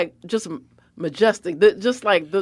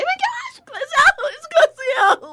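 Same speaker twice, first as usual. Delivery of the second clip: much higher, slow shrieking fan voice; screaming fan; 3 dB louder, slower than usual. Retold or imitated. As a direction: squeal like a starstruck fan.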